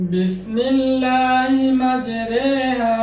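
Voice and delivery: মাজরেহা with re, not ri; imala. Shown as a demonstration. Quran teacher chanting মাজরেহা slowly with imala. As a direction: chant বিসমিল্লাহি মাজরেহা slowly, leaning ri toward re. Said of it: "যেমন".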